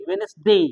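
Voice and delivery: वेंसडे as "wens-de", no first d; silent-D pronunciation. Shown as a demonstration. Teacher corrects pupils, वेंसडे with silent d. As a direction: go straight from n to s in वेंसडे. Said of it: "यानी दी हम नहीं".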